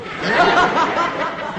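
high, loud cackle of laughter; burst of laughter. Acted. Father laughs at "melted down.

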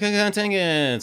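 high, slow put-on voice; mocking imitation. Teasing co-host about his Facebook post, mocking imitation.

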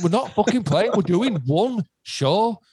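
high-pitched, exasperated telling-off; retold exasperation. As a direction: re-enact setting an insistent fan straight, pitched well up.

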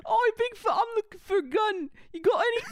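put-on high, loud comic British accent; hammed-up British boy wizard; Harry Potter. Boy wizard asking Bigfoot for a gun, high mock British voice. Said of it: "Harry Potter".

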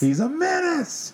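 high, slow mock-outraged catchphrase; J. Jonah Jameson. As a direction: cry it out as a hammed-up angry boss, stretching it. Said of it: "who played J. Jonah Jameson".

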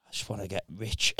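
low, soft, private thought; inner voice murmur. Retold or imitated. His inner voice during a chat, low soft murmur. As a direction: murmur it like a secret wish.

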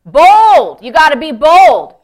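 forceful preacher's punch; bold exhortation. Shown as a demonstration. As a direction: drive it home boldly. Preacher models being bold.

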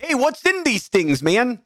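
mock-Canadian with a cartoon coach's twang; Canadian accent. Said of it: "that kinda sounds like Coach Z".

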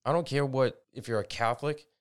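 ordinary delivery

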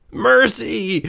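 whispered, high plea for mercy; terrified cry. A narrator's reading. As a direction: gasp it out in terror. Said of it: "cried Scrooge".

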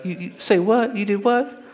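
high-pitched motherly disbelief; acted mom, aghast, pitch jumping up; worked-up mother. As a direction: question your son in rising, incredulous alarm. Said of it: "worked up".

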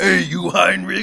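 laughing tough guy. Retold or imitated.

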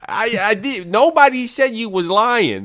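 high, loud comic indignation; hammed-up yelled rebuttal; mock outraged protest. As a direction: protest loudly as if falsely accused.

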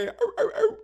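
high, loud seal yelps; short squealed seal barks; happy baby seal bark. Acted. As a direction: yelp like a delighted baby seal. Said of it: "like a happy baby seal".

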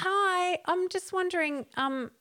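high, sweet, overly polite voice; a lightly voiced, high, chirpy traveller; polite hello. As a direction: put on a chirpy polite front. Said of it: "And I said to him".

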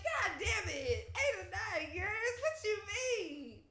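slow, high, breaking-up disbelief; retold shock at the eight or nine years; stunned disbelief. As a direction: stretch it out, half laughing, half crying. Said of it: "I was like".

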